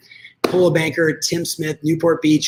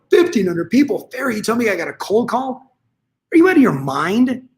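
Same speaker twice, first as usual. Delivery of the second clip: higher-pitched imitation of a disbelieving listener; mock incredulous objection; 6 semitones higher than usual. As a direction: throw the number back as an outraged question. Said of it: "Now, before you go".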